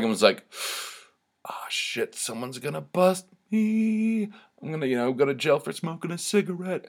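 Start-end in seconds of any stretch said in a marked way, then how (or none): 1.4-2.1 breathy whispered "ah, shit" under the breath; a guilty whisper after the first drag; mock whispered curse. Imagined panic on first drag. 2.1-6.9 jokingly imagining the cops coming for a cigarette; mock paranoia. Imagined fear of getting caught.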